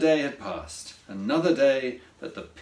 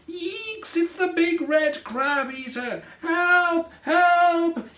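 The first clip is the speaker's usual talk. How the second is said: very high storybook shriek, growing louder; terrified shriek; 13 semitones higher, 4 dB louder, slower than usual. A narrator's reading. Craig seeing a monster looking in, shrieking. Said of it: "he shrieked".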